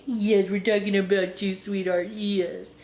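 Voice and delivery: doting coo to a little dog; real affectionate pet-directed aside. Real, not acted. Cooing to her dog mid-story.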